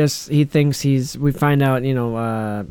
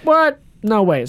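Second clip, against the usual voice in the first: feigned surprise; 6 semitones higher than usual. Retold or imitated. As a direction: act as if you had no idea. Repeats suspect's feigned shock sound bite.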